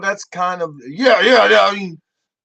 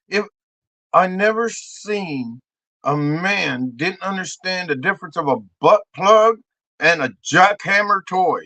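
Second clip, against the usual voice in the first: ordinary delivery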